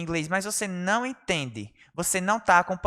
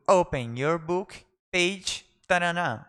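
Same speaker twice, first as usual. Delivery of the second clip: slow, firm classroom command; drawn out for learners to copy; slow model command; slower than usual. Shown as a demonstration. Teacher modelling class instruction, slowly. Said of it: "teacher models phrase slowly".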